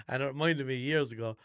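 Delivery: ordinary talk, nothing marked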